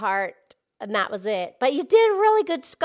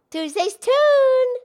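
big show title call; 10 semitones higher, 4 dB louder than usual. Acted. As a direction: trumpet the segment name to the crowd.

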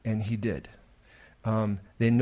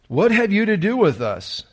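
evil crying out; 5 semitones higher, 6 dB louder than usual. Acted. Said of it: "the evil stands up and cries out".

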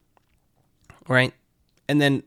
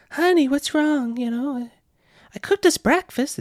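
worried wife voice. Retold or imitated. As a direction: play the timid wife.

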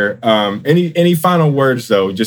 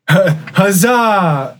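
mock cheer; 8 semitones higher than usual. Echoing friend's cheer.

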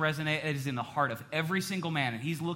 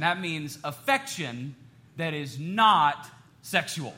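ordinary talk

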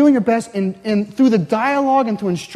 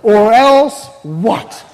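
drawn-out, louder cheeky challenge with a laugh; defiant child; 2 semitones higher, 6 dB louder, much slower than usual. Retold or imitated. Child challenging the parent's threat, defiant and slow. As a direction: dare the parent, slowly and cheekily. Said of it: "the question that comes from the child's mouth".

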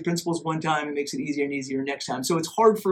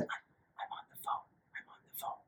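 sharp whispered hush; urgent whispered shushing; 20 dB quieter, faster than usual. Retold or imitated. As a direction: whisper it while pointing at the phone. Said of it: "with that whisper that we've all done".

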